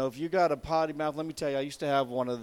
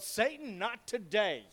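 retold yell; 6 semitones higher, slower than usual. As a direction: imitate a defiant cry at moderate volume. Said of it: "and I yelled".

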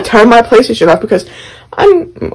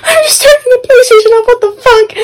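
mock whiny protest. Teen protesting to mom, whiny and indignant. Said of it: "I was like".

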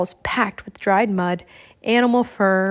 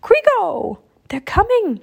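high, urgent bird-like cry; pitched-up, loud raven alarm; warning call; 10 semitones higher, 4 dB louder than usual. A narrator's reading. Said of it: "Annie was calling to him".

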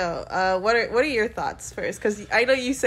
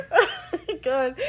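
laughing; 8 semitones higher than usual. Real, not acted. Laughing at the slip.

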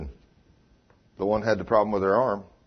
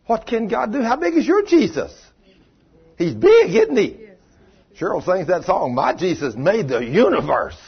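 higher, louder, rising preacher's call; fired-up preaching; 8 semitones higher, 6 dB louder than usual. Acted. Preacher asking how big your Jesus is, fired-up preaching. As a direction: stir up the congregation.

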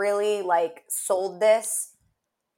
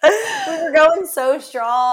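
slow laughing words, higher and louder; 6 semitones higher, 6 dB louder, slower than usual. Real, not acted. Laughing along with her co-host at the memory. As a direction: laugh it out slowly.

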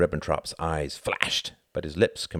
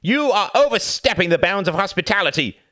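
much higher, louder protest; cried out; 8 dB louder than usual. A narrator's reading. Envoy crying out at Churchill, high and loud. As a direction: cry out in offence. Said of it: "cried von Ribbentrop".

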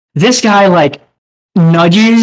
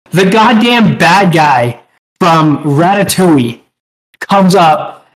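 ordinary talk

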